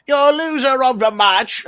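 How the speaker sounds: loud, high mock-British announcement; butchered British accent. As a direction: shout it in a badly faked British accent. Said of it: "you give your best English accent".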